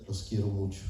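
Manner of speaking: low, soft fatherly affection; tender reassurance. Retold or imitated. Wife's father after hugging his children, retold low and tender. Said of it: "Y nos decía".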